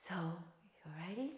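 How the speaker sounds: slow whispered check-in; hushed "you ready". Real, not acted. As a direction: whisper it slowly to the class. Checking in with class.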